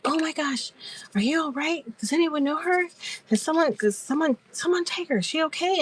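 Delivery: high-pitched, worried questions; imitated concern. Host asks her audience about the laughing viewer, worriedly. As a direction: imitate a flustered host checking on someone, voice up high. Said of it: "all that concern she had for me".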